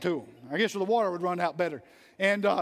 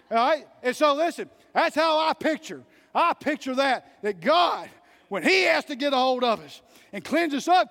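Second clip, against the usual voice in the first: raised, fervent pulpit delivery, climbing high; preaching; 5 semitones higher, 3 dB louder than usual. Acted. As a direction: preach it hard to the church. Preacher at full force again.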